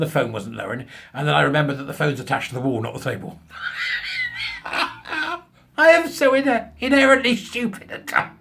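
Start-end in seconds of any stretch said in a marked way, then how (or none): 3.5-4.6 a high, squealing burst of laughter; shrieking laugh. Real, not acted. Laughing at his own blunder with the phone, high squeal. 4.6-8.3 high, slow, laugh-soaked words; laughing self-mockery. Real, not acted. Telling viewers how daft he is, high and laughing. Laughing at his own mistake.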